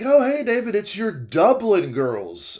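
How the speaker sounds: high, loud start sliding into a slow drawl; stretched-out mock ladies' greeting; elderly ladies' greeting. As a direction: imitate gushing old ladies saying hello. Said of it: "They're like".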